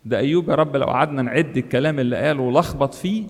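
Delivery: normal talking voice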